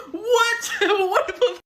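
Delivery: high-pitched squeal through laughter; incredulous laughing. Real, not acted. Storyteller cracking up at date's mom invitation, incredulous laughing. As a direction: laugh helplessly in disbelief.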